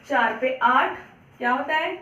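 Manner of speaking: ordinary talk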